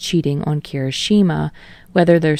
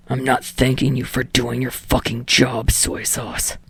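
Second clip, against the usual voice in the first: put-on lower, scornful young man's voice; surly Bakugo; 5 semitones lower, 3 dB quieter than usual. A narrator's reading. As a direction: grumble it down at the manager. Bakugo snaps at his manager.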